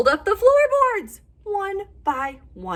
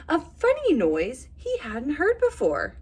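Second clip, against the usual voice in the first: usual speaking voice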